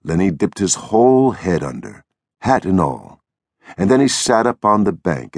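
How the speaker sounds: usual speaking voice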